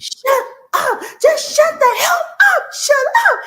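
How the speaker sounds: high, loud, sobbing scream; crying shout. Retold or imitated. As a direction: scream it through tears at wit's end. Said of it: "And I'm crying. I'm bawling".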